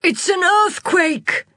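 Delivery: high, loud, slow shout. Acted. Mechanic shouting to the crowd, high and slow. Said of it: "shouted Ayla".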